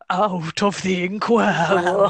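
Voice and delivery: slow, sinister demon voice; demonic voice. Pretend. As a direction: drag it out like a demon. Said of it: "Is it the demonic voice?".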